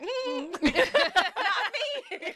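slow, amused and pleased; laughing smug "not me". Retold or imitated. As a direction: laugh it off like you're free. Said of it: "I'm like".

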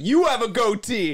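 high, loud, drawn-out jeer; retold taunting call. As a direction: call it after someone walking away. Calling after girls walking away.

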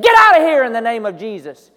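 loud rebuke; commanding shout at the demon; rebuking command. Retold or imitated. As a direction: order the demon away. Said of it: "And he said".

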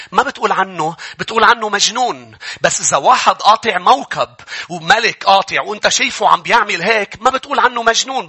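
ordinary talk

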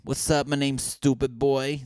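hyped-up rapper intro. Retold or imitated. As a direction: announce yourself like a wannabe rapper. Mock failed rapper introducing himself.

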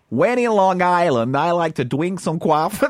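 slow, hammed-up Long Island accent, coffee as kwarfee; mock Long Island accent. Shown as a demonstration. Host taking his turn, hamming up a Long Island accent. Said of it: "I'm gonna have a go".

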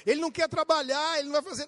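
ordinary delivery

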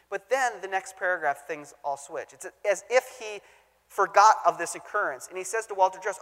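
ordinary delivery

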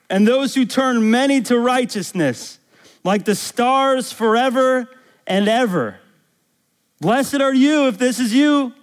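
high, loud, exultant preaching; impassioned preaching. Real, not acted. Preacher promising the congregation they will shine, impassioned preaching. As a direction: proclaim it with rising force, stretching the key words.

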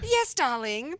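light mock British accent on the darling. Host answers co-host in mock British accent. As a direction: keep the British bit going, teasing. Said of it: "do the entire show in a British accent".

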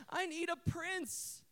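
high, drawn-out damsel plea; imitated little girl. Eight-year-old girl wanting a prince, imitated high plea. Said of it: "Little eight-year-old girl".

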